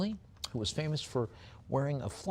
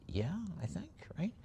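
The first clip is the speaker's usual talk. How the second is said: whispered aside; 8 semitones higher, 9 dB quieter than usual. Real, not acted. Unsure aside after presidential fact.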